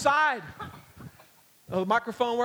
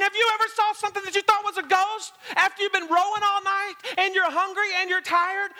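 usual speaking voice

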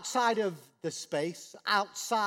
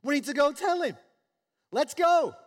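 higher and louder, excited urgency; eager servants; 7 semitones higher, 9 dB louder than usual. Retold or imitated. Servants hurrying to tell their master, excited. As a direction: rush off with good news. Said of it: "his servant's going".

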